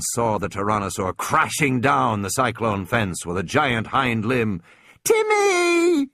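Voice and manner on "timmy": very high cry; terrified child's cry. Acted. Little girl shrieks for her brother outside in the rain, terrified. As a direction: shriek his name in fear.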